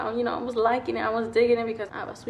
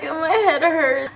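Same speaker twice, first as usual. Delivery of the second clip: slow, higher whimper of pain; whiny complaint; 5 semitones higher, much slower than usual. Retold or imitated. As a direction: drag it out like a hurt kid. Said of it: "and I was like".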